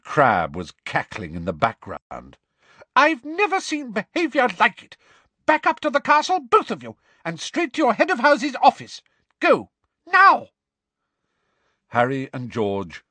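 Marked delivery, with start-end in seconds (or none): 2.9-10.5 high, loud telling-off; furious scolding. Acted. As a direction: order them off in outrage. Furious teacher orders the brawlers off.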